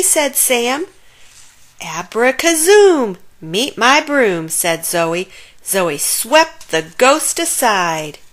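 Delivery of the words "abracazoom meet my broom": magic spell. A narrator's reading. Zoe casts magic spell.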